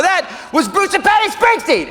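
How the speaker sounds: high, excited outburst; acted high-pitched thrill; starstruck announcement. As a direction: squeal the news to your girlfriend. Excitedly telling girlfriend who called.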